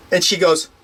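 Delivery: ordinary talk, nothing marked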